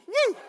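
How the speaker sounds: comic "woo"; startled whoop. Acted. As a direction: yelp as if poked behind. Repeats poked bottom reaction.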